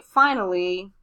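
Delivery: slow, word-by-word dictation; dictating. Shown as a demonstration. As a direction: spell it out as you write. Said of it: "So I'm gonna say".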